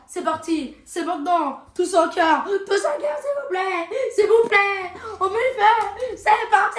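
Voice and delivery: very high, loud rallying yell; real hype shouting. Real, not acted. Rallying everyone for the booster opening, shouting. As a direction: shout to whip up a whole crowd. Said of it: "Crie pas, crie pas".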